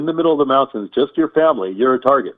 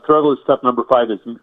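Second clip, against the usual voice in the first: ordinary delivery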